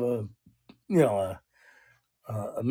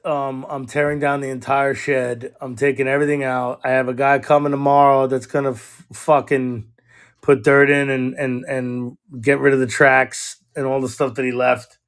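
ordinary talk

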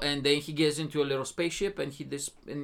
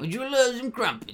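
high put-on British accent; mock British; 6 semitones higher than usual. Offering crumpets as the British guy, mock British accent. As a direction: play a polite caricature Brit. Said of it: "He sounds British".

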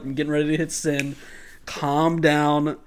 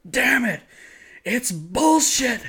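mock furious father; 8 semitones higher, slower than usual. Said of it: "his father screaming".

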